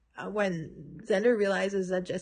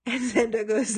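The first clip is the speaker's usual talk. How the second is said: ordinary talk, nothing marked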